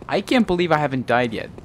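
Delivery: ordinary talk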